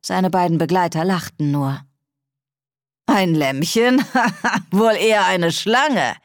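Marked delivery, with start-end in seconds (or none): 3.1-6.1 mocking laugh mid-line; laughing soldier. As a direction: laugh it off, amused and scornful. Soldiers laugh at biting girl.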